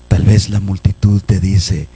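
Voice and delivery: normal talking voice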